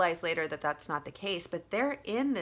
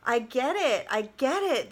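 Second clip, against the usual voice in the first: higher, louder desperate insistence; urgent pleading; 6 semitones higher, 6 dB louder than usual. Retold or imitated. Telling viewers how she pleaded in hell, urgent and louder. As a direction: beg the vision to stop, loudly.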